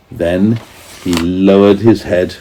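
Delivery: usual speaking voice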